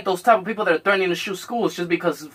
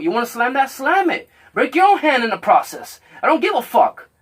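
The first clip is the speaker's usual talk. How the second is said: raised, higher voice egging him on to let it out; loud urging; 8 semitones higher, 4 dB louder than usual. Retold or imitated. Telling Richard to vent, voice raised and pushing. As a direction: get louder and higher, daring him to break things.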